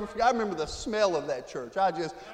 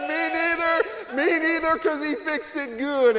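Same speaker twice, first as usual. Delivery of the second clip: high, slow, drawn-out and emphatic; moved agreement; 8 semitones higher, 5 dB louder, slower than usual. Retold or imitated. Preacher answering his weeping brother, high and slow. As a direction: stretch it out high, deeply moved. Emotional reply to crying brother.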